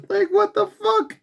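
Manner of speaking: squeaky high disbelief, half crying; incredulous. Retold or imitated. Vlogger reacting to church protest in shrill disbelief. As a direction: squeal it like you can't believe it. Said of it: "I'm like".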